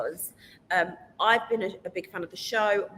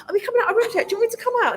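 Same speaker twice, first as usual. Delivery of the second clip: high, loud eager question; calling out; 11 semitones higher, 6 dB louder, much faster than usual. Retold or imitated. Bored contestant asking production to let her out, high loud calling. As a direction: call over to the crew, raised voice.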